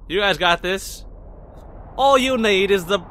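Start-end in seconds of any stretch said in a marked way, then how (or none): 2.0-3.0 louder, higher amateur British accent; attempted British accent. Shown as a demonstration. Player offering Ansem his voice, attempted British accent. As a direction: try a British voice, not very well. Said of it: "voice of British me trying".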